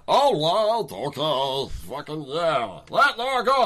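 high, loud squawky crab voice; Zoidberg voice. Acted. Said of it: "Strider is Zoidberg".

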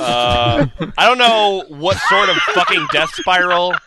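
incredulous rant. Real, not acted.

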